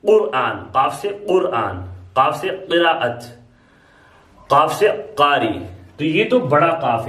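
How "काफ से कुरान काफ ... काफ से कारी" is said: careful qaf examples; slow heavy qaf. Shown as a demonstration. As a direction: say काफ से कारी slowly with a throat q. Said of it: "थोड़ा भारी निकलता है".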